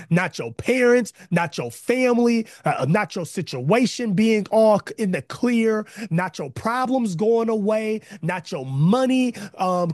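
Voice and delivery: loud, rising preacher's cry; full-force preaching. Acted. Pastor preaching hard to his online congregation, loud and fervent. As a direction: drive every line home to the church.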